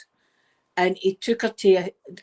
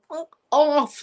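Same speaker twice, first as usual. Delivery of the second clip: mock shout; 6 semitones higher than usual. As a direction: shout the tide away. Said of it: "He's shouting to the".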